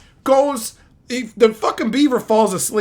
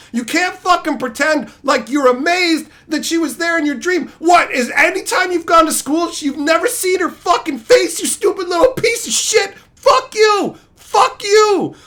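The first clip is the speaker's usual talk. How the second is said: high-pitched comic tirade, building to yells; indignant rant; 7 semitones higher, 3 dB louder than usual. Acted. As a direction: berate them with mounting outrage. Ranting at the cartoon beaver.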